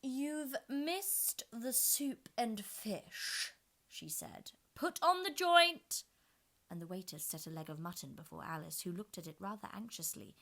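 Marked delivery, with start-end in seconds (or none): 0.0-3.5 high, slow, haughty queen; Red Queen voice. A narrator's reading. 4.7-6.0 high, loud imperious order; queen's command. A narrator's reading. Red Queen gives an order.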